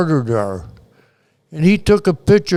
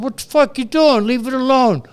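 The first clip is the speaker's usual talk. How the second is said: angry retort; 6 semitones higher than usual. Retold or imitated. Confronting police in his car.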